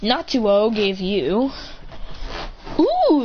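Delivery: usual speaking voice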